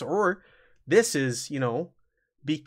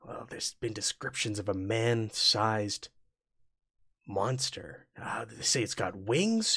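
low, hushed and secretive, partly whispered; a nervous townsman half-whispering rumours; 3 semitones lower, 5 dB quieter than usual. Acted. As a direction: lean in and tell it under your breath. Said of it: "in a hushed tone".

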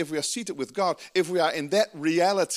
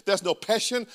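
ordinary talk, nothing marked

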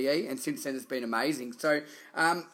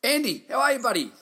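excited greeting; 9 semitones higher, 4 dB louder than usual. Real, not acted. Host greets guest enthusiastically.

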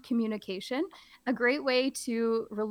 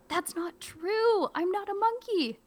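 much higher, slow indignant whine; mock protest; 10 semitones higher than usual. Teacher insisting to the kids, high mock protest. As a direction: drag out the denial high. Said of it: "I'm really trying to play it up".

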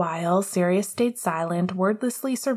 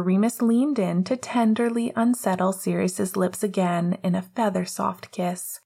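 ordinary delivery

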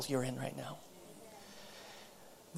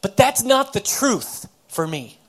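higher, louder voice of an exasperated woman; frustrated retort; 6 semitones higher, 8 dB louder than usual. Retold or imitated. Woman rebuffing her counselor's quoted scriptures, frustrated retort. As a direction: push back hard, insisting on each phrase. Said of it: "she kinda slammed it down on the".

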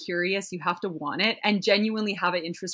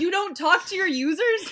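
mock disbelief; 11 semitones higher, 3 dB louder than usual. Retells incredulous question to friend.

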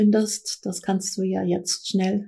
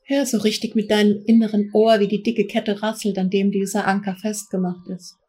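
guided meditation. Acted. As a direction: guide them gently into trance. Leads a guided trance journey.

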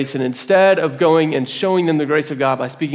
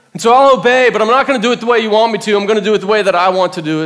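high, loud, defiant compliance; frustrated; 6 semitones higher, 5 dB louder, faster than usual. Acted. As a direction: hurry out a stubborn, exasperated retort. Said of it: "I'm frustrated".

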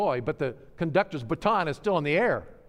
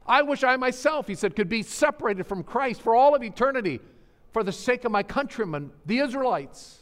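high, pleading cry of sorrow, a bit louder; anguished plea; 10 semitones higher, 6 dB louder than usual. Retold or imitated. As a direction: pour out a grieving apostle's lament, pitched high. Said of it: "I'm in unceasing sorrow".

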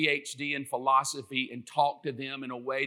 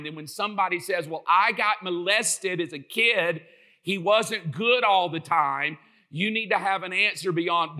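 rising preaching; 3 semitones higher, 4 dB louder than usual. Acted. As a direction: press the congregation harder with each line. Preaching climax.

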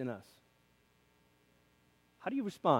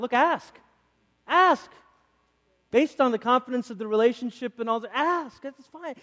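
emphatic ask; 8 semitones higher, 4 dB louder than usual. Retold or imitated. Voicing Jesus' command to ask.

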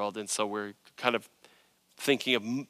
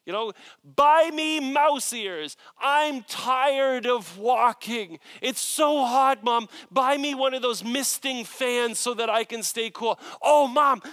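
put-on high, loud whining child's demands; whiny demanding kid; 12 semitones higher, 5 dB louder, slower than usual. Pastor imitating a whiny kid at Disney World. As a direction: whine like a tired kid tugging at mom. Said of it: "they're bawling and yelling".

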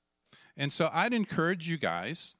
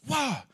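high excited yelp; joyful cry grabbing bills; triumphant whoop; 10 semitones higher than usual. Retold or imitated. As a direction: whoop as your hands fill. Hands fill with bills.

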